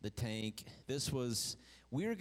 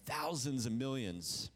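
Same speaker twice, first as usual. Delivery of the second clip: ordinary delivery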